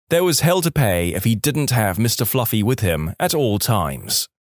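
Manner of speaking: ordinary delivery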